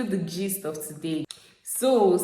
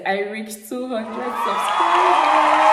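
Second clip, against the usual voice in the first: loud, high, drawn-out cheer; excited celebration; 7 semitones higher, 7 dB louder, slower than usual. Real, not acted. Vlogger announcing a milestone to viewers, excited shout. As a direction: shout the milestone in triumph, stretching it out.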